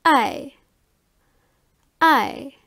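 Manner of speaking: "I" said as the German ä vowel, a long open eh; German umlaut sound. Shown as a demonstration. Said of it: "is pronounced like".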